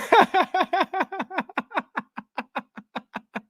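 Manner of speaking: long high laugh fading out. Real, not acted. Streamer pictures Mike heated, helpless laughing. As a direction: laugh until out of air.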